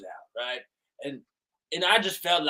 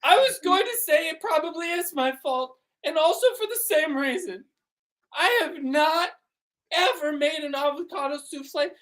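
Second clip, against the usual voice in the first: high mom voice; 13 semitones higher than usual. Acted.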